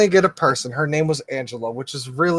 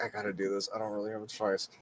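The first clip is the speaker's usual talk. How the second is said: much softer, lower, duty-bound drone; resigned tone; 10 semitones lower, 17 dB quieter, faster than usual. Retold or imitated. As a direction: sound like you have no choice. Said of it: "she's got that tone in her voice".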